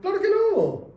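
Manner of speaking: high, loud, emphatic cry; emphatic exclamation. Real, not acted. Speaker to class, loud emphatic answer.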